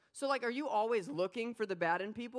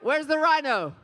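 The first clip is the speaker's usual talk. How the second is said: shouting up to the crowd; loud, high call to the room; 6 semitones higher, 12 dB louder than usual. Real, not acted. Preacher calling out to crowd.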